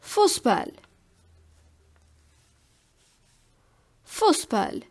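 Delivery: "Fußball" with a long u and a sharp voiceless s for ß; eszett example. Shown as a demonstration. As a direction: say "Fußball" as a careful German model word. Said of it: "For example".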